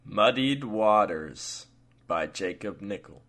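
measured, unhurried storyteller reading; story narration. Acted. Host reading his story aloud to listeners, measured narration. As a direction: read it like an audiobook narrator, steady and even.

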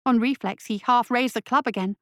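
usual speaking voice

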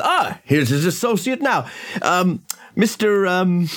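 high-pitched host making introductions; Augusta. Acted. Host introduces the associate, high and hesitant. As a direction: pitch it high, drawing out the forgotten name. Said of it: "Augusta says".

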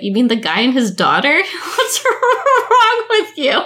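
high disbelieving question breaking into laughter; incredulous laughing. Retold or imitated. Correcting husband about TV ages, incredulous and laughing. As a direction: correct him and crack up, slowing on the end. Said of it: "And I'll be like".

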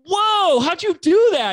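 loud, very high-pitched "whoa" of amazement; imitating a wowed onlooker; amazed outburst. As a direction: act out someone utterly impressed. Acting out an amazed admirer.

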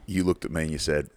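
ordinary delivery

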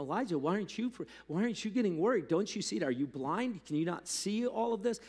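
high, rushed, acted panicky questions; freaking out. Servant pressing a calm Elijah about the army, acted freaking out. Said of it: "that person that just freaking out".

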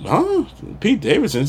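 a high, incredulous huh from the retold dad; surprised dad. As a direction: play the dad caught off guard, lightly imitated. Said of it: "And the dad said".

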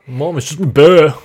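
put-on loud, high, slow child voice; whiny kid voice. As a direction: whine it like a little kid. Blurted random quote.

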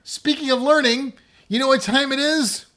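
high, loud segment intro; excited. Shown as a demonstration. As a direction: build up the next segment. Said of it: "Let's try that again".

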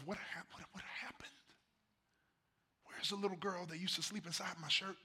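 quietly wondering where she went; hushed wonder. Real, not acted. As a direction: ask it under your breath. Wondering aloud about grown daughter.

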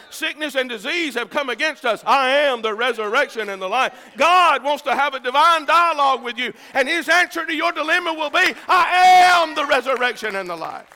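high, rising sermon cadence up to a shouted praise; building preaching. Real, not acted. Preacher promises God's answer to the dilemma, building to a cry. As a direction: build the refrain to a ringing Hallelujah.

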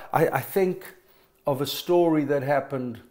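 usual speaking voice

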